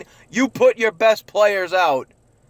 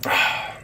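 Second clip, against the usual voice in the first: heavy sigh; 5 dB quieter than usual. Real, not acted. Disappointed fan after loss.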